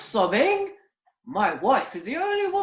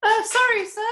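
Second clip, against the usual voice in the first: loud, high put-on lady's voice; woman's voice; 12 semitones higher, 5 dB louder than usual. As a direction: squeak it out as a badly disguised wife. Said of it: "Woman's voice".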